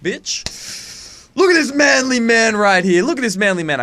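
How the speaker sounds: loud, high comic bragging; mock boast. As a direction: show yourself off with hammed-up bravado. Mock boasting about looking older.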